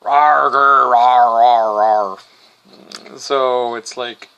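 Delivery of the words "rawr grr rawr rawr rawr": loud, low mock lion roars; low growling rawrs, played for laughs. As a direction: roar like a lion, hammed up. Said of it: "the lion goes".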